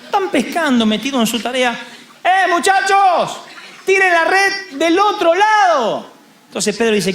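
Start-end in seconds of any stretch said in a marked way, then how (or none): 2.2-6.1 high, slow, drawn-out call; calling from shore. Acted. Lord tells fishermen to cast the other side, slow high call. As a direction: stretch each word across the water.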